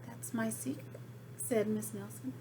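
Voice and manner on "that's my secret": quietly coy; a hint of mischief, softly; soft teasing secret. Teacher keeping her secret, soft and coy.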